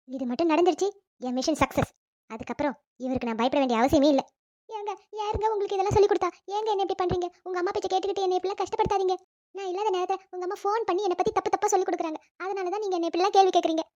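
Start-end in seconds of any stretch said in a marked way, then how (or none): 4.7-13.8 whiny put-on victim plea; imitated fake crying. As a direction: badly copy a friend's tearful act. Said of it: "சின்ன பொண்ணு மாதிரி நாமளும் பிட்ட போட்டு". (போலியாக அழுவது போல் கெஞ்சல்)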